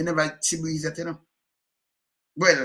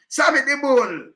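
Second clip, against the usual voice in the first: higher, louder, slow Sammy the Bull; exclaimed; 9 semitones higher, 8 dB louder, slower than usual. Real, not acted. Listing snitches to the host, exclaimed. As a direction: announce the name with relish.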